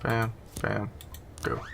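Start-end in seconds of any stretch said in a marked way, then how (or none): none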